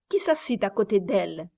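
plain reading voice

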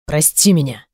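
low, heartfelt apology; with feeling. A narrator's reading. Man asking the woman's forgiveness, with feeling. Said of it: "проговорил он с чувством".

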